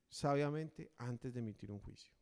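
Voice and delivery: ordinary talk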